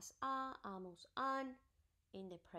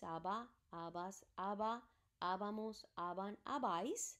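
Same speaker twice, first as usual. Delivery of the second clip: usual speaking voice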